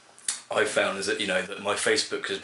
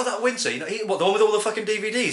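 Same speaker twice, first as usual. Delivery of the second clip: higher-pitched imitation of people talking; mock gossip; 9 semitones higher than usual. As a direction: put on an exasperated onlooker, pitched up. Acting out what people say.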